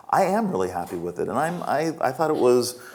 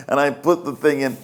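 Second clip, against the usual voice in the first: ordinary delivery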